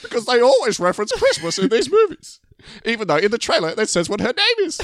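high, squeaky mock-pubescent voice; mock your co-host in a whiny falsetto; exaggerated high-pitched impression; Matty D. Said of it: "going through puberty in your impression".

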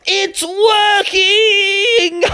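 high, very slow sung-out shout; joyful drawn-out shout; thrilled cheer. Acted. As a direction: crow in triumph. Techno Bear's machine finally works.

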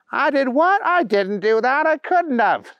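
put-on a childlike hero mourning his spilled treasure; Booger's wail. Booger's voice in story.

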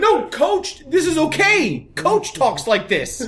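put-on high, slow little boy explaining; boy explaining to dad that coach talks this way; pleading kid. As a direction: earnestly defend the coach like a child. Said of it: "I had to be like".

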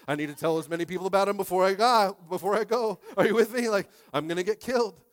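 loud, pushed preaching of his story; impassioned testimony. Acted. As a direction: tell it to the crowd with rising urgency. Loud testimony of boot camp.

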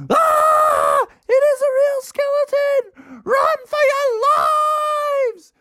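high screaming alarm; terrified shout. A narrator's reading. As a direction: scream run for your lives. Kids see skeleton.

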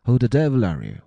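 loud, high challenge; startled demand. A narrator's reading. Doctor challenging the stranger in the corner, forceful demand.

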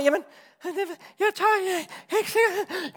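high, faltering scaredy-cat voice; hammed-up nervous protest; acted timid hesitation. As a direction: stammer it out, too scared to jump. Acting hesitant person at chasm edge.

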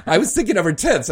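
fast, high, through laughter; laughing. Real, not acted. Laughing at own stumble.

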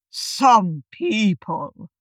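very slow, drawn-out put-on voice; pointedly slow, pompous egg creature; Humpty Dumpty. A narrator's reading. Humpty scorns Alice without addressing her, slow and pompous. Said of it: "said Humpty Dumpty".

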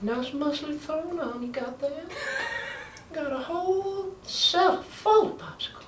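put-on high, far-off old man voice with laughs; a creaky, high-pitched old man, breaking into laughter; Herbert impression. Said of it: "Herbert impression".

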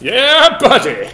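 loud, high whooping catchphrase; yell. Pretend. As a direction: holler it out to demonstrate. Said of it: "yell, "Yeah, buddy," until your next turn".

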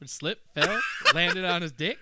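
words squeezed out through laughter; laughing. Real, not acted. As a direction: laugh while finishing the joke.

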